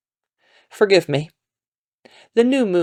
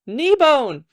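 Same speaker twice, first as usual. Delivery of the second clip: high-pitched character voice; new voice; 8 semitones higher than usual. A narrator's reading. New trader explaining patella to another, high voice.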